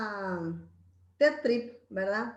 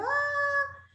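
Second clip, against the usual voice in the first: high, drawn-out imitation cry; mock child's wail; 14 semitones higher, 4 dB louder than usual. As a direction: imitate a child left crying with one long high wail. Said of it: "se queda llorando, como diciendo".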